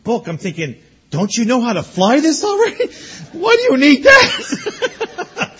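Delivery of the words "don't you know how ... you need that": much higher, stretched, laughing disbelief; laughing mock question. Pastor asking the pilot why he needs a book, laughing. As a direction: tease him through laughter.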